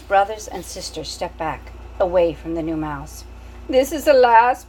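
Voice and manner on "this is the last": put-on loud French-accented proclamation; proclaiming French mother. Antoinette proclaiming from her bed, French accent. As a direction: declare it grandly in a French accent. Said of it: "proclaimed Antoinette".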